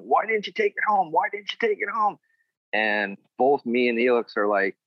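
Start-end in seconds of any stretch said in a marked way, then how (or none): 0.0-2.2 mock yelling Arn. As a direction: yell it like a furious agent. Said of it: "started yelling".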